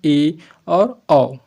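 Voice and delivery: ए and औ stretched very slowly, each vowel held; slow vowel demo. Shown as a demonstration. Teacher modelling half-open vowels for the class, very slow. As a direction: draw out ए and औ so students hear the sound. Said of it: "जैसे कि".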